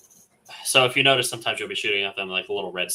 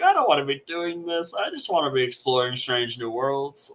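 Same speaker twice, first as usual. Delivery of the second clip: high, hammed-up complaint building to a loud, drawn-out plea; exasperated whine; 7 semitones higher, 8 dB louder, slower than usual. Player moaning about debt collecting instead of exploring, hammed-up exasperation. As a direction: play up the put-upon explorer, pitched high.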